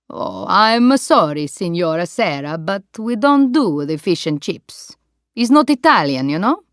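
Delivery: put-on jolly Italian owner with a broad Italian accent; Italian restaurant owner. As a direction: beam at the birthday guest, Italian style.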